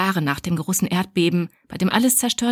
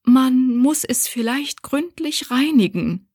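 higher, slow, appeasing voice; smooth things over gently, slow and higher; soothing; 6 semitones higher, 3 dB louder, slower than usual. Acted. The mother calming the angry Corinna, higher and slow. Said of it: "versuchte zu besänftigen".

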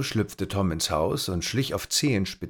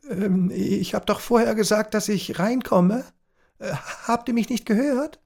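put-on nervous boy; 13 semitones higher, 3 dB louder than usual. A narrator's reading. Tom voiced, stammering excuse.

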